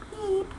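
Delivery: small high, soft toot; imitated horn beep. Driver honking at the curves, small imitated horn beep. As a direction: peep like a distant car horn. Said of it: "honking the horn".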